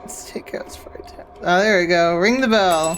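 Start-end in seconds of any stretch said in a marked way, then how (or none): 0.0-1.1 unvoiced whisper, slower. Real, not acted. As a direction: whisper it to yourself. Quiet aside while playing.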